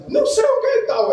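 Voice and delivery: a high, shrill-pitched shriek of a scolding grandma; mock yell. Grandma who forgot her diazepam yells at the child, high and piercing. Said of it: "aí dá aqueles gritos".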